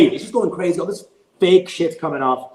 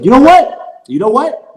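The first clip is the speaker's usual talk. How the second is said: high, loud indignant challenge; retold angry outburst; 10 semitones higher, 9 dB louder than usual. Girlfriend turns on him in public, high angry outburst. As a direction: imitate her snapping at him, pitched way up.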